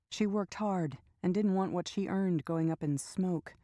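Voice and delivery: ordinary delivery, nothing marked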